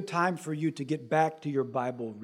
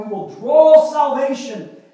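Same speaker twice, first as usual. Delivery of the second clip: joyful recitation; 5 semitones higher, 8 dB louder, slower than usual. Acted. Quoting psalm sung by priests.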